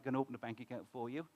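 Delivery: ordinary delivery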